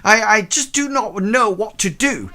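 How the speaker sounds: high, loud, fast flustered housekeeper; panicked housekeeper. Acted. Housekeeper bursting in on Howard, high and panicked. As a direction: flap about in high breathless panic.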